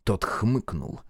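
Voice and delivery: plain reading voice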